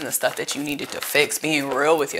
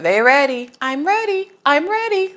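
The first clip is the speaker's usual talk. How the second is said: very high squeaky cartoon voice; high-pitched cartoon sponge shouting he's ready; SpongeBob impression; 11 semitones higher, 4 dB louder than usual. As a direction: chirp it like SpongeBob. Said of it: "Like SpongeBob".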